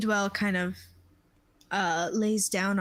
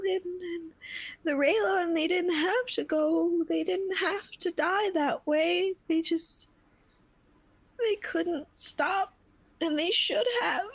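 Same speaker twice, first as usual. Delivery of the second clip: much higher, grief-stricken and pleading; sobbing grief; 9 semitones higher than usual. Acted. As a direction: pour out the loss of the little one. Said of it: "And Edwell is sobbing".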